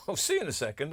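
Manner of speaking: ordinary talk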